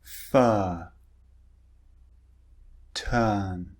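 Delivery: each word slow and clear, R silent, long vowel; British long er vowel, R not pronounced; model words for repeating. Shown as a demonstration. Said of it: "listen and repeat after me".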